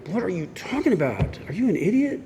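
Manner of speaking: imitating arguing back at the sponsor in a huff; retold angry outburst. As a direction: fire it back like you think he's an idiot. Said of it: "'Cause I'd get so mad".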